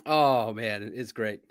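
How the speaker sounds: usual speaking voice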